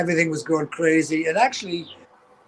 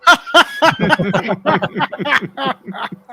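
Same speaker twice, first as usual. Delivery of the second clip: cracks up laughing at the memory; hearty laugh; 7 semitones higher than usual. Real, not acted. Laughing at his own story.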